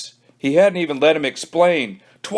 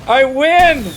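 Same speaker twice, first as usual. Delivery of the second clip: louder, high breathless cheer; exhausted triumphant gasp; gasped win; 13 semitones higher, 7 dB louder than usual. Acted. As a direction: pant it to the sky. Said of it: "gasped Billy".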